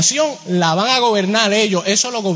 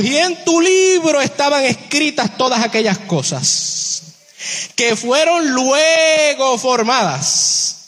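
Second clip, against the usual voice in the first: slow proclaiming scripture read; 4 semitones higher, slower than usual. Acted. Preacher rereads the psalm slowly.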